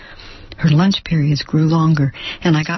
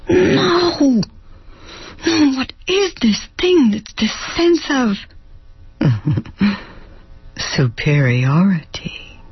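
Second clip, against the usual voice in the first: high, pained cry of no; horrified denial; 6 semitones higher, slower than usual. Acted. She cries out against her own prejudice, pitched high. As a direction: push the thought away.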